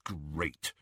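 low, soft irritated grumble; irritable. A narrator's reading. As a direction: grumble it under your annoyance. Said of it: "said Ron irritably".

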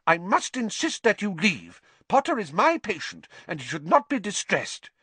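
angry. A narrator's reading. Nurse ordering visitors out, angry. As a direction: defend the patient with firm anger. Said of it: "said Madam Pumphrey angrily".

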